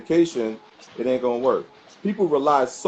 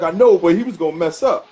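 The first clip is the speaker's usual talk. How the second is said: loud protest; 4 semitones higher, 6 dB louder than usual. Retold or imitated. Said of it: "He was like".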